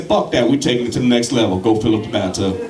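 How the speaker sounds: low, laughing bravado; a hint of a reckless young stoner; cocky stoner. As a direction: drop the voice, mock-tough. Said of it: "I said".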